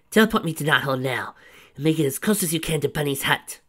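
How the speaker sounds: wife furiously ordering the computer to teleport her; angry order. Acted.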